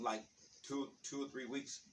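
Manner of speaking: ordinary talk, nothing marked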